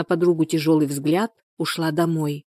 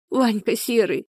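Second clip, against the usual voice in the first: tearful, higher-pitched outburst; sobbing; 4 semitones higher than usual. A narrator's reading. Людмила names her attacker to her friend, sobbing. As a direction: let the name out on a sob. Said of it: "схлипнула Людмила".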